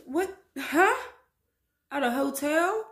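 high, loud incredulous what; can't believe what she's seeing; shocked disbelief. Real, not acted. Vlogger to viewers about the hotel, disbelieving. Reacting to hotel kissing clip.

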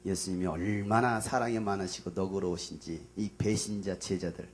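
ordinary talk

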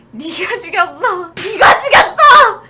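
furious high yell; yelling accusation. Acted. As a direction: scream the blame at them. Mock accusation yelled at the chat.

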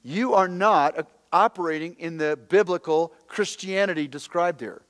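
loud, emphatic sermon delivery; preaching at full force. Acted. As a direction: drive every point home to the crowd. Preaching to congregation.

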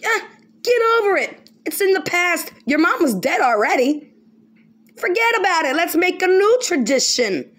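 high, loud, scoffing brush-off; mocking the new owner telling the children to move on; mock dismissive heir. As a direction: wave the children's grief away mockingly. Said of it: "I should tell them".

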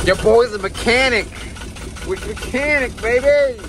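high, loud showboating boast; cocky brag. Real, not acted. Sailor bragging to viewers about his repair, high and loud. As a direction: crow about the fixed engine. Bragging after fixing the starter.

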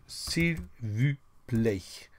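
s'il vous plaît drawn out slowly, each syllable separate; very slow modelling. Shown as a demonstration. Teacher slowly modelling for the class, drawn out. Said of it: "You see, I do, I do".

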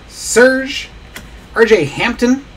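ordinary delivery

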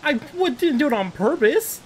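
high, cut-off cry of disbelief; baffled outburst. Real, not acted. Player reacting to a surprise hit, shocked squeak. Confused after lightning.

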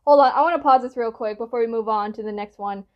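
ordinary delivery

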